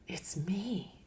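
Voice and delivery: a soft, voiceless whisper. Real, not acted. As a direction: confess it under your breath. Whispered admission.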